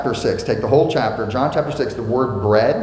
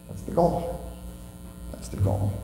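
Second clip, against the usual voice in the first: whispered emphasis; 10 dB quieter than usual. Acted. Repeated key line, whispered.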